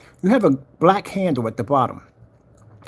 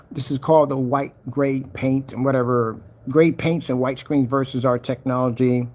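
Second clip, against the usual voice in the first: ordinary talk, nothing marked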